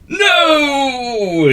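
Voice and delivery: very high, loud "No!"; wizard's shout. A narrator's reading. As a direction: cut him off with a shout. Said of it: "shouted the wizard".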